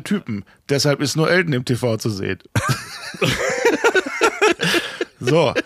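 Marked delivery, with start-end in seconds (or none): 2.4-5.1 high laugh. Real, not acted. Reacting to the listener's joke.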